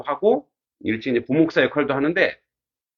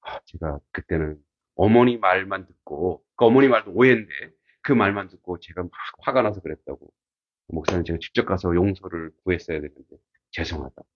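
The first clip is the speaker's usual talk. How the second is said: retold apology; 7 semitones lower than usual. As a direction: humbly voice the man saying sorry. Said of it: "사과해요".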